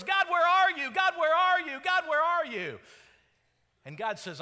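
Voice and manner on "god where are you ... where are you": full-force shout, pitched very high; top-of-voice yell. Acted. People in trials crying out to God, yelling at the top of their voice. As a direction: yell it up to heaven, insistently. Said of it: "we yell at the top of our voice".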